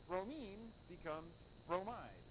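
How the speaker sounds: usual speaking voice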